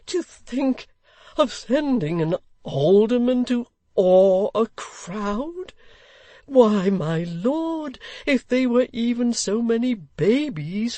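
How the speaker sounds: high, slow, aggrieved pleading; distressed old gentleman. Acted. Old gentleman begging the Lord Mayor, high and slow.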